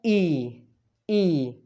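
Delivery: ई as a long "ee", said separately; Spanish I vowel. Shown as a demonstration. Teacher drills third vowel for learners, long isolated ई. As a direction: drill ई as in see. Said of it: "उसको कैसे pronounce करना है?".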